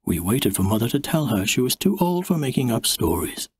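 usual speaking voice

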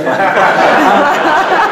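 a sudden laugh that climbs high; high rising laugh. Real, not acted. Admitting to the audience he failed, high laughter.

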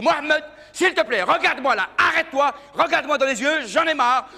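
put-on high, loud pleading to a shy boyfriend; exasperated girlfriend. Girlfriend pleading with shy Mohamed, high and exasperated. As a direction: beg him to finally look at you. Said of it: "Elle lui dit".

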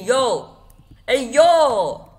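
loud, high call for attention; calling out loudly. Real, not acted. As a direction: shout to grab someone's notice. Calling out to someone appearing.

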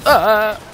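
short, loud scream; startled yell. Real, not acted. Player caught out mid-round, startled loud yell. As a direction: cry out in sudden shock.

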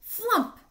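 high, loud story sound effect; sudden high, punched "flump"; imitated thump. A narrator's reading. Storyteller voices the bat landing, high loud thump.

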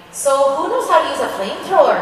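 sing-song Minnesota line drifting towards Irish; Irish-tinged sing-song. Shown as a demonstration. Actor showing fans how her scene line went astray, sing-song Irish-tinged accent. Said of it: "what other accent has a sing-song".

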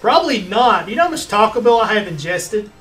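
ordinary talk, nothing marked

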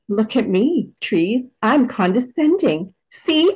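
hammed-up superior air, pitch swooping upward; sing-song mock haughtiness, climbing high at the end; playfully condescending. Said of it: "really embodied that condescending part of me".

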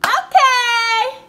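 loud high excited okay; excited shout. Real, not acted. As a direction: burst in excited. Excited video opener.

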